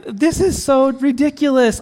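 high exasperated self-quote; mock exasperation at the burn. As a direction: throw up your hands at yourself. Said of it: "I'm like".

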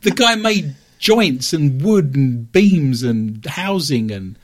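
drawn-out, stressed listing; slow and deliberate. Real, not acted. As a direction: slowly spell out each job. Emphatic listing of carpentry.

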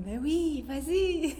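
high, loud cheering encouragement; enthousiaste. Retold or imitated. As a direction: cheer her on, voice jumping up. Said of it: "il m'a dit". (encouragement enjoué)

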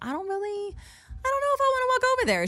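tiny high-pitched hesitant voice; a little nervous. Retold or imitated. Woman unwilling to cross the stage, squeaky nervous. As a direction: squeak out your reluctance, hammed-up. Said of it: "kind of a little nervous".

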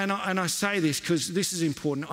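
ordinary talk, nothing marked

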